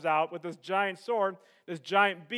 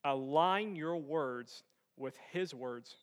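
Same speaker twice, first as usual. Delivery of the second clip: solemn hushed preaching; 9 semitones lower, 9 dB quieter than usual. Real, not acted. Preacher telling congregation the battle is the Lord's, solemn hush. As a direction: bring it right down, quiet and weighty.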